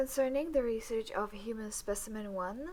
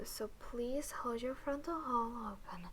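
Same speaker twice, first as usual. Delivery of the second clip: ordinary talk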